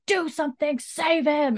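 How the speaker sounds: mock shout. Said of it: "shouts at Claire".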